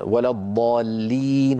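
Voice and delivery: ولا الضالين slowly, the long ii held; recited example. Shown as a demonstration. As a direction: draw out الضالين. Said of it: "ياء ساكنة قبلها كسرة". (تلاوة ولا الضالين بتأنٍ)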